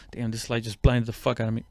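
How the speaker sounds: ordinary delivery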